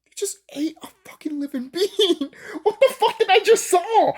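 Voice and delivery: laugh-cry in horrified disbelief; shocked disbelief. Retold or imitated. Telling viewers his horror at the gingerbread scene, shrieked high. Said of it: "And I'm just like".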